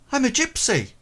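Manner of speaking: put-on high young boy explaining; boy's voice. Boy declaring himself.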